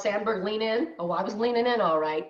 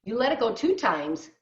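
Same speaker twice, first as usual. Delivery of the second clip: ordinary delivery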